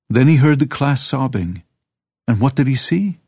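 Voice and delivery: ordinary delivery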